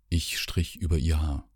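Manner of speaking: ordinary delivery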